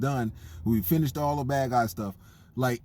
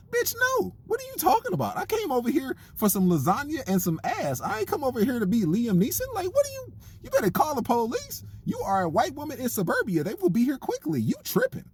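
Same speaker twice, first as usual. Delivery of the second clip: much higher, outraged refusal; exasperated date; 10 semitones higher than usual. Retold or imitated. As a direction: play the date guy, horrified at the ask. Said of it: "I'm like".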